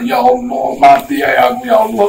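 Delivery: mock the sinner's panicked cry; retold cry to God. Abandoned sick man crying out to God, high imitated wail. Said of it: "baru dia teriak".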